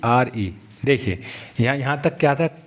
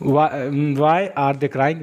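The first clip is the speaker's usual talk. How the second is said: very slow, every word stretched; teacher's drawn-out example; slow demo; much slower than usual. Shown as a demonstration. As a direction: drill it slowly for learners. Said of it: "समझ रहे हो दोस्तों?".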